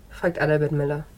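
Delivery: ordinary delivery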